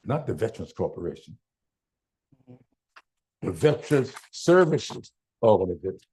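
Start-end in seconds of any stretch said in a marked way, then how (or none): 3.4-6.0 restating the name, weight on Services; stress on services. Real, not acted. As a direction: repeat the title leaning on Services. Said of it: "I want to emphasize the word service".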